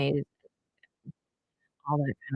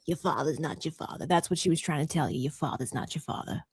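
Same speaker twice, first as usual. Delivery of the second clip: low, quiet, offhand medium impression; zero compassion; 4 semitones lower, 10 dB quieter than usual. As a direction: deliver it coldly, low and soft. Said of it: "zero compassion".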